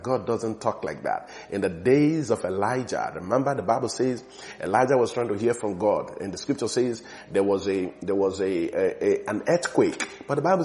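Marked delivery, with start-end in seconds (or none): none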